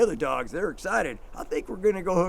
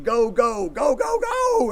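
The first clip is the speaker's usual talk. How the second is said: excited go; 7 semitones higher, 5 dB louder, slower than usual. Retold or imitated. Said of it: "They must have thought I was saying".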